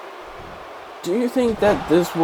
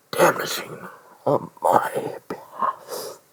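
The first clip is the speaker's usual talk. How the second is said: whisper; 8 dB quieter than usual. Real, not acted. Whispered aside while ranting.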